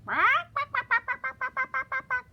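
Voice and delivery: squeaky loud bark bark; high yappy barking. Shown as a demonstration. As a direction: yap like a tiny dog. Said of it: "what do they say".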